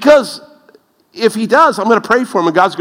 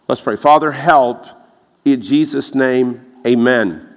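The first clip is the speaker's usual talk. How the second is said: ordinary talk, nothing marked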